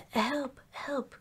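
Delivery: high-pitched storybook panic; frightened cry. A narrator's reading. Piglet spots a heffalump in the trap, frightened cry. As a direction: cry for help in a high little voice. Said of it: "cried Piglet".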